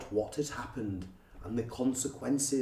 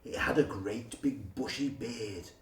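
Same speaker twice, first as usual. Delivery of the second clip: usual speaking voice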